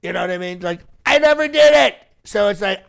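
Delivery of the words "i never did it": "I never did it" punched high and loud; loud confident denial. Shown as a demonstration. President teaching the press how to deny, loud and sure. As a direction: hit "did" hard, full of bravado. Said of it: "very confident".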